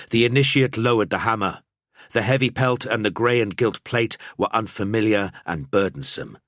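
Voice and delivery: ordinary delivery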